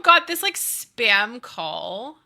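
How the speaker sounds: usual speaking voice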